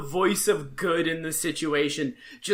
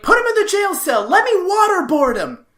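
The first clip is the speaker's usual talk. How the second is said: high, loud, frantic mock rant; hammed-up bloodthirsty demand, pitched way up; Barney furious; 10 semitones higher, 6 dB louder than usual. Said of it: "He is furious".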